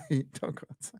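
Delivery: words squeezed through real laughter; laugh, then repeat the retort. Real, not acted.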